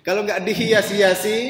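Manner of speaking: "dihias-hiasi" pushed up through a chuckle; laughing emphasis. Real, not acted.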